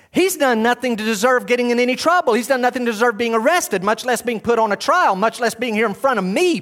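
high, loud exasperated protest; Pilate's thoughts. Acted. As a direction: plead his innocence as Pilate. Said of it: "Pilate would think".

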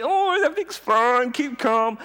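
high, strained fake-calm reassurance; a hint of a frantic parent pretending all is fine; mock panicky parent.

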